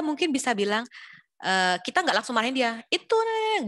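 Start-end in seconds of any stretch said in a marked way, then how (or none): 2.9-3.6 put-on sharp, high snapped scold at a child, much higher pitch; the wrong way: a quick angry high snap; mock scolding. Parent snapping high at a daughter who broke a plate, mock scolding. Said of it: "bukan gitu".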